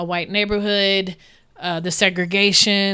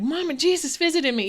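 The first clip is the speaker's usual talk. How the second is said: put-on higher, eager little-kid voice; a five-year-old running to tell mom; child bursting with news; 8 semitones higher than usual. Five-year-old telling mom about Jesus.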